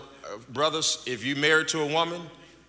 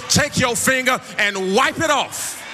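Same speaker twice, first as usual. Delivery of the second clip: preaching at full force; 6 semitones higher, slower than usual. Acted. Preacher urging wives to wipe their men's tears, whooping. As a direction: ride the music into a whoop.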